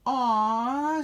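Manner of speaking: high, loud, touched coo; blushing. A narrator's reading. Cleo hears the baby say Mama, high blushing coo.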